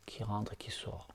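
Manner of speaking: hushed whisper, barely voiced. Teacher following the breath with lying students, whispering.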